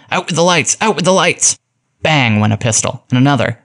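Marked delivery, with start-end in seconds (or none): 0.1-1.6 high, urgent cry from across a bar; shouted order. A narrator's reading. A second voice from the bar as police burst in, shouting. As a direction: shout the order in a much higher voice. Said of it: "A second voice, apparently from the bar, shouted".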